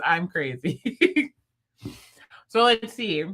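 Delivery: ordinary talk, nothing marked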